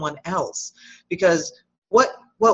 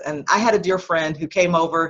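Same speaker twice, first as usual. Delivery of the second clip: usual speaking voice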